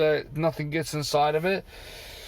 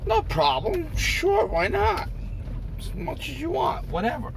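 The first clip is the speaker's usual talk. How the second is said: much higher, sing-song fake generosity; mock obliging; 12 semitones higher than usual. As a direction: play the overly accommodating owner. His sarcastic answer to them.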